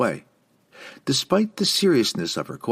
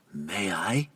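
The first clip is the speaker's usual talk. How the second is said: hushed, breathy request; barely voiced question; whispering; 6 dB quieter than usual. A narrator's reading. Elf asks quietly.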